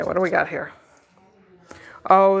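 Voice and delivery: normal talking voice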